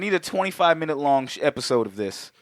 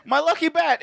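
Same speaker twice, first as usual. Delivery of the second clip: much higher mocking whine; high whiny echo; 13 semitones higher than usual. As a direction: squeak the lost bat line.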